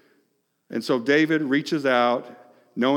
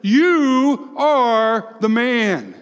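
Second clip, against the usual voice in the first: slow, pointed accusation, raised; Nathan accusing; 10 semitones higher, 6 dB louder, much slower than usual. Retold or imitated. Prophet Nathan confronting King David, slow and accusing. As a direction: nail him with each word. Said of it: "He points his finger at David and he says".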